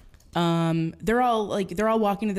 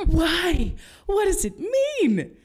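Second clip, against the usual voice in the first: much higher, slow innocent asking; naive high question; 12 semitones higher, 4 dB louder, much slower than usual. Retold or imitated. Naive kid asking her friend what spooning means. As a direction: ask it wide-eyed, slowly.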